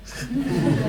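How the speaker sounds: a high, breathless burst of real laughter. Real, not acted. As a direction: let a genuine laugh escape. Amused at her own story.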